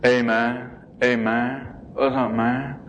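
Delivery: lightly done low, dazed drawl of a burnout; stoned burnout voice. Retold or imitated. Showing how he walked around on drugs, a light stoned drawl. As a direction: drift through it like a lightly stoned kid. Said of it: "I walked around just like this".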